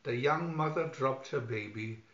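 ordinary delivery, nothing marked

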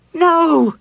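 very high, loud cry of horror; terrified scream. A narrator's reading. Cleric sees a disembodied head beside her, screaming. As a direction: scream in sheer terror.

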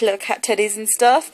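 normal talking voice

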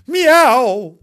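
high, loud drawn-out meow; cat meow. Acted. The kitten replies to its new name, loud meow.

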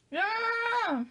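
high mock scream of alarm; imitating brother's scream. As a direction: yelp like the startled brother. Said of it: "Hizo así como". (grito agudo imitando al hermano)

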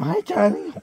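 high sweet voice. Real, not acted. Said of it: "Raise your voice and talk to him".

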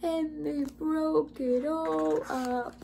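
put-on high, slow, drawn-out Baby Bear; wee small voice. A narrator's reading. Baby Bear finds his chair broken, slow high wail. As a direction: wail it slowly like a little cub. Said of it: "in his wee small voice".

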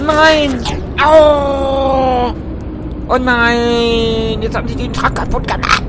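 high, drawn-out cry of dismay; long, loud howls of protest; loud wail. Real, not acted. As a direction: wail over your wrecked truck. Wails as the truck is wrecked.

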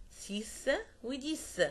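سيس and ديس ending in a hissed s; French X in سيس sounded as s. Shown as a demonstration. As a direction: say سيس وديس slowly with a final s. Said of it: "ينطق إس كمان".

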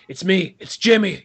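a touch of a sly reveal; lightly voiced British gangster; Jimmy reveal. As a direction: unmask with a grin. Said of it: "he's just".

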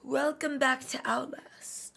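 slow unvoiced whisper; whispered intro. Shown as a demonstration. Youtuber trying her greeting as a slow whisper. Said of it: "Like, where am I going?".

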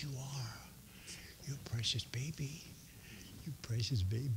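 soft, hushed cooing to a little one. Real, not acted. Preacher cooing softly to a little child in the pews.

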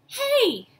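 high, loud "Hey!"; sharp indignant shout; cry out. A narrator's reading. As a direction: yell out in protest. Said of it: "Ash cried".

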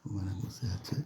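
soft, hushed aside while hiding; speaking very softly. Real, not acted. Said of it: "আমি খুব আস্তে আস্তে কথা বলছি".

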